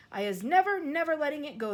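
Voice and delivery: never release it; determined cry. Acted. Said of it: "the B F G cried".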